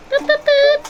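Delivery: high bright squeaks at a pet; high squeaky cooing. Real, not acted. Cooing to hamster.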